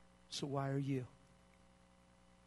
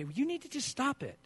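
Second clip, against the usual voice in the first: irritated snap; 10 semitones higher than usual. Retold or imitated. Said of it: "Now there's passion in your voice in the wrong way".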